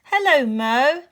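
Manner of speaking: shouted greeting. A narrator's reading. Uncle from the dust cart to his niece, shouted greeting. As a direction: call out a drawn-out hello. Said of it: "he shouted".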